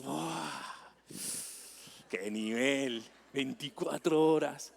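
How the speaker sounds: the group, stunned and admiring; mock awe. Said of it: "Y todos". (asombro fingido y suave)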